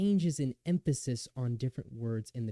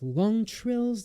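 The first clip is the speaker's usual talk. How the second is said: drawn out high; 9 semitones higher, 6 dB louder than usual. Shown as a demonstration. Lecturer reading a quote on singing, stretched high and slow. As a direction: say long trills higher and slower, stretched.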